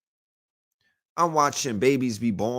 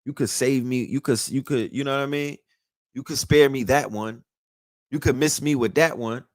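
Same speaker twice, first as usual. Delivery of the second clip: normal talking voice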